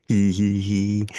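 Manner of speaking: loud mock mad giggle; a silly fake-crazy hee hee; faking madness. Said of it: "No, I'm just kidding".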